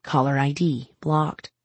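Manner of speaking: ordinary delivery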